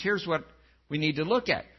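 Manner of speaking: ordinary talk, nothing marked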